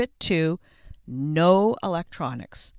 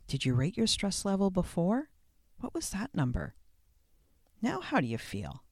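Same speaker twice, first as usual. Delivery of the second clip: ordinary talk, nothing marked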